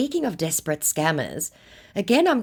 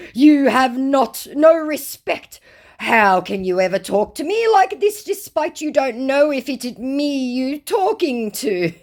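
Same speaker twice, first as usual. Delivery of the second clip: high, loud, drawn-out indignation; hammed-up offended scammer; mock mortally offended; 9 semitones higher, 6 dB louder than usual. Scammer scolds the woman doubting him, hammed-up indignation. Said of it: "our mortally offended scammer".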